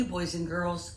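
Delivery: normal talking voice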